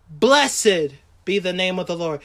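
low, hushed blessing; reverent praise. Acted. Preacher voices Job blessing God after loss, reverent praise. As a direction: bless the Lord gently and low.

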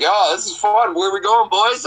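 high, cocky and carefree; cocky douchebag. Retold or imitated. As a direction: play the smug kid in the back seat. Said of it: "I'm just being a douchebag".

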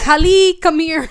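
loud, high mock call to someone. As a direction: call her over in a playful high shout. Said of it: "maybe he was pronouncing it".

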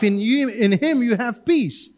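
normal talking voice

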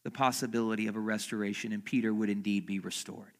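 loud, high, driving sermon voice; preaching at full force. Acted. Pastor stirs the congregation about Peter, preaching loudly.

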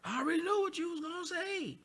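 high mimicking voice of him; mocking imitation. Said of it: "he keeps saying".

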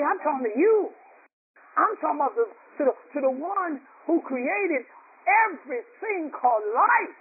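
high, earnest pulpit plea; impassioned preaching. Real, not acted. Preacher showing how to address God as Daddy, impassioned preaching. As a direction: call out to God with stretched phrase ends.

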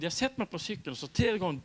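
ordinary talk

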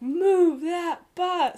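loud, high, very drawn-out call; host's rallying call. Retold or imitated. Host calling for the new house reveal, rallying call. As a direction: stretch it out like a TV host's big reveal.

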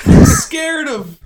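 drawn-out high quavering fear; slow, overplayed frightened wail; mock scared.

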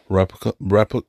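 tripping over the word "Replica", cut off at "repli-"; tongue-tied stumble. Real, not acted. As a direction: fumble "Replica" and break off midway through "repli-". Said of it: "I can't even say it".

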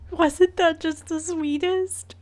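high, slow gushing coo; loud sharp voice. Real, not acted. Gushing after baby clip.

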